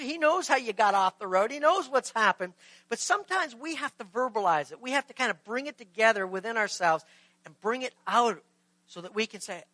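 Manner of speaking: normal talking voice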